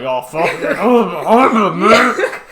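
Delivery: loud, high mock moaning through laughter; hammed-up overexcited groan, laughing; mock lusting excitement. Podcaster acting out seeing birds, hammed-up excitement. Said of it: "I'm like".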